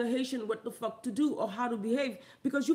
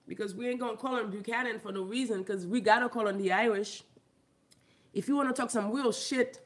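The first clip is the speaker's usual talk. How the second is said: ordinary talk, nothing marked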